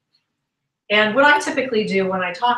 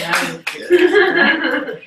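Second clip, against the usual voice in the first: laughing; 6 semitones higher, 7 dB louder, much slower than usual. Real, not acted. Laughing at an audience remark.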